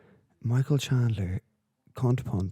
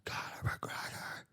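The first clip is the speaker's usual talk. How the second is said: breathless, whispered call-out; 3 semitones higher, 7 dB quieter, slower than usual. Retold or imitated. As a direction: gasp it out, barely voiced. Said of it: "out of breath".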